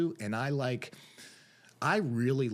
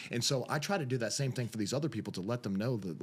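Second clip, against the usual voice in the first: usual speaking voice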